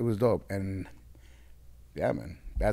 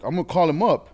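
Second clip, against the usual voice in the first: loud, excited announcement; hyped up; 8 semitones higher, 13 dB louder than usual. Retold or imitated. [name] tells [name] he'll call, loud and excited. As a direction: pump it up like you can't wait.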